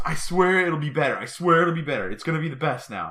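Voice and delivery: imitated wife, high and pleading; wife swearing. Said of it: "And she goes".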